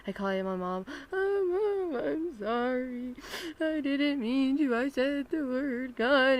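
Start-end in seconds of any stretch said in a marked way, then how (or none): 1.1-6.4 high, loud, drawn-out wailing; hammed-up sobbing confession; mock crying. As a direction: wail it like a kid pleading with mom. Acted tearful phone confession to mom.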